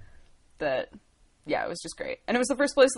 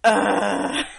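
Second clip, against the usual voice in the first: annoyed groan; 7 dB louder than usual. Retold or imitated. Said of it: "we were like".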